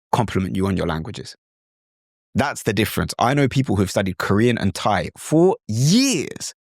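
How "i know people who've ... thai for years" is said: usual speaking voice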